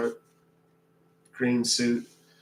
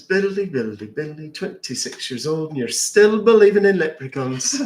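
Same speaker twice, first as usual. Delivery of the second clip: higher, louder mocking Irish leprechaun; gloating Irish brogue punchline; 4 semitones higher, 5 dB louder than usual. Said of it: "I'm a leprechaun".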